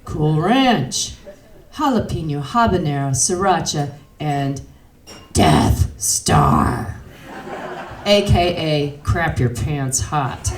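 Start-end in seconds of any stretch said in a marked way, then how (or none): 5.3-9.0 ominous announcer voice. Acted. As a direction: growl it like a dreaded name. Dramatic name of hottest flavor.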